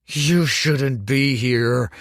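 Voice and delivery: wheeze. A narrator's reading. Grieving son confronts Dorian at the grave, wheezing slow anger. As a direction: force it out through grief and rage. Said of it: "he wheezed".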